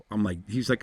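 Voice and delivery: ordinary talk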